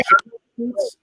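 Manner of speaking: high excited call-out; suddenly hyped, calling out; excited shout. Real, not acted. Excited at seeing the cleats.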